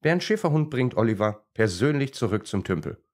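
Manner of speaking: ordinary delivery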